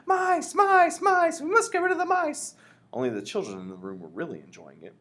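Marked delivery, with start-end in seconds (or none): 0.1-2.6 very high, loud alarmed yell. A narrator's reading. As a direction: yell it like a panicked crowd. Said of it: "everyone was yelling".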